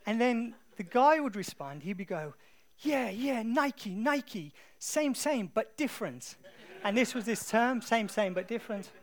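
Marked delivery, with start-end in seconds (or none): 2.8-6.4 put-on high, loud Thai-accented street seller; insistent market seller with imitated Thai accent; attempted Thai accent. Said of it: "That was the best accent I could do". 7.8-8.9 loud, raised imitation Thai accent; attempted Thai accent. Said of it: "That was the best accent I could do".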